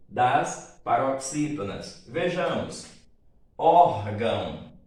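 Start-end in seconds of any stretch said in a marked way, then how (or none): 3.6-4.7 ÓR in "órgão" loud and drawn out; stress on first syllable. Shown as a demonstration. Teacher showing students the strong syllable, ÓR stressed in "órgão". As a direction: hit ÓR hard, let "gão" drop away. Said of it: "Qual é a sílaba tônica?".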